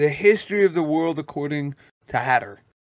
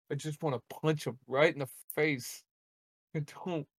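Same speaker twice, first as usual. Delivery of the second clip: ordinary delivery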